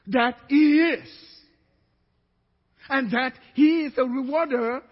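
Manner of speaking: slow, building to loud proclamation; preaching scripture. Acted. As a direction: proclaim the verse, climbing in force.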